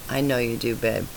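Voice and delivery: a dying man's faint reply; weak and soft. A narrator's reading. Dying husband answers softly.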